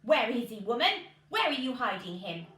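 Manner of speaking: loud booming giant; big loud giant sniffing out a man. A narrator's reading. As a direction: bellow like a hungry giant. Giant voiced in a story.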